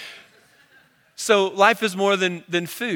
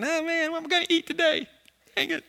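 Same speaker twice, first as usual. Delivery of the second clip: high, soft little bird fretting; a tiny worried bird muttering; 10 semitones higher, 4 dB quieter than usual. Retold or imitated. Pastor voicing a fretting bird in his yard, high little bird voice. Bird's inner voice, sermon joke.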